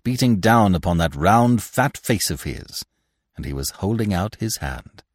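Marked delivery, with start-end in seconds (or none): none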